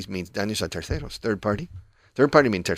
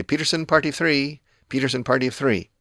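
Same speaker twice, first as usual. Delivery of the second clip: lightly voiced hostess calling a name, higher; intercom call; 6 semitones higher than usual. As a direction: call the party, fading out at the end. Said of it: "And they say".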